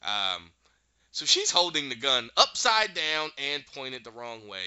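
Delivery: ordinary delivery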